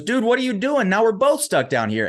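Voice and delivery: loud, high-pitched mock outrage; exasperated disbelief. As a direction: exclaim it high, as a stunned man. Acted disbelief at friend jumping in.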